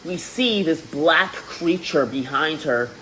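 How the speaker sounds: ordinary talk, nothing marked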